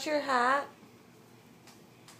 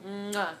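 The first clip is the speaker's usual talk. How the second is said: put-on baby kisses; 5 semitones lower, 3 dB quieter than usual. Kissing phone for baby.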